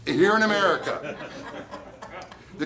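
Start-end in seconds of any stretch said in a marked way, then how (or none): 0.0-1.2 a laughing, incredulous repeat, louder and higher; laughing echo. Real, not acted. Echoing the interviewer about trials in America, laughing. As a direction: laugh as you echo the question back.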